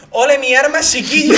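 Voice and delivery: imitating an Andalusian street seller, high and loud; a cheerful Sevillian "Ole mi arma" called out; Sevillian accent. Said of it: "¿Pero con acento correcto o con acento imitado?". (acento sevillano imitado, agudo y alegre)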